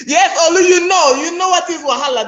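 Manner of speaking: high laughing voice. Real, not acted. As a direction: talk through a laugh. Laughing with audience member Olu.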